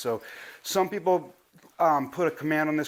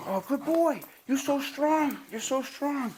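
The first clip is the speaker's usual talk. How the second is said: much higher, excited praise voice; puppy praise; 9 semitones higher, slower than usual. Real, not acted. Praising puppy during tug.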